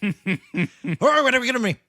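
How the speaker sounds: loud, high, garbled mock Irish accent; unintelligible Irishman. Old Irishman answering the whiskey question, in a garbled Irish accent. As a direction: slur it into incomprehensible Irish. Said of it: "you got to tell me what this man is saying".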